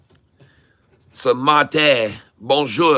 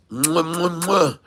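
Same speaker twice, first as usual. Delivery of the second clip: kiss sounds; 4 semitones higher, slower than usual. Pretend. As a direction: blow three quick air kisses at the chart. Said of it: "Give it a kiss".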